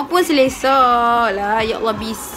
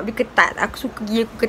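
speaking through touching fingers; fingers on lips; 3 dB quieter than usual. Real, not acted. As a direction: hand partly over mouth. Seen 2: Fingers rest on lower lip mid-sentence, not whole mouth covered.

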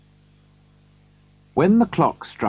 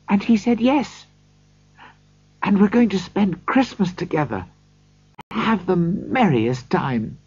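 put-on a loving young girl's voice, higher; a little sister gently pleading with her brother to come home; 7 semitones higher than usual. A narrator's reading. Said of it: "she said lovingly".

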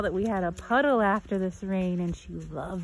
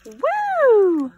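excited pet talk; 8 semitones higher, 8 dB louder, slower than usual. Real, not acted. Cheering dog in puddle.

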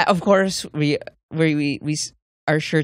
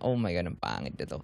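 quiet, quick grumble to herself; low, muttered disgust at a bad show; a quiet, disgusted aside; muttered complaint; 10 semitones lower, 11 dB quieter, faster than usual. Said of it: "first episode pa lang".

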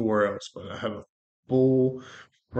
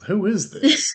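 high, loud startled "who is this"; shocked; 11 semitones higher, 7 dB louder than usual. Retold or imitated. Answering phone, hearing her daddy instead: shocked. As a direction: blurt it out in total surprise. Stunned at caller being daddy.

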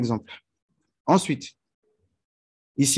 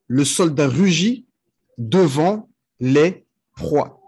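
slow translation, ending word by word; slow dictation; 3 semitones higher, much slower than usual. Shown as a demonstration. Teacher giving students the full French translation, slow dictation. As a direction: space out the final words deliberately.

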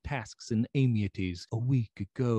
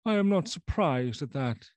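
put-on doomed old doctor; 8 semitones higher, 4 dB louder than usual. Lanyon replies to the lawyer.

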